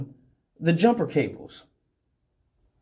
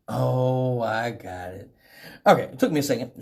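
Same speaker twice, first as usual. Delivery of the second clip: usual speaking voice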